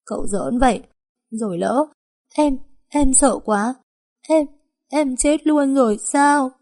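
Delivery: high, slow, sobbing words; girl whimpering tearfully at the master after his scare. A narrator's reading. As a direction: blubber it out through tears. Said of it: "mếu máo nói".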